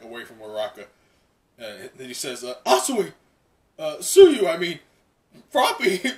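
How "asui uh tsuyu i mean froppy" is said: high, loud fumbling call across the fight; excited hero call, higher and louder; Deku calling out. Acted. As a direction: yell her name, correct yourself mid-shout. Deku calls out to Froppy.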